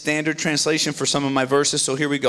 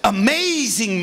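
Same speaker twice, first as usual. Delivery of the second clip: "Amazing" slow and louder, full of wonder; awed amazement; 7 semitones higher, 3 dB louder, slower than usual. Acted. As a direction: let the wonder swell slowly on "Amazing". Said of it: "Moses said to himself".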